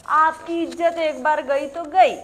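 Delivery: loud, high jab at the audience; pointed taunt. Acted. Answering her own question about honour, loud taunt. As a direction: ring it out over the crowd.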